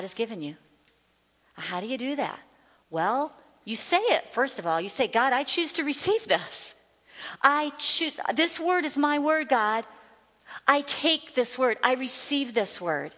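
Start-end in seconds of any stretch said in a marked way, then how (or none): 5.1-13.1 deliberate, higher-voiced prayer of resolve; firm declaration. Shown as a demonstration. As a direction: declare it to God, pitched up. Models a declaration of faith.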